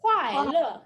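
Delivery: "Kuai le" loud, chopped downward; dropping tone. Shown as a demonstration. Teacher finishing the greeting drill, emphatic falling tones. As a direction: punch "Kuai le" down firmly. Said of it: "a dropping tone".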